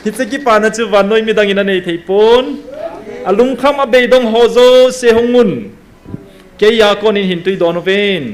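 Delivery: loud, driving sermon delivery; full-force preaching. Acted.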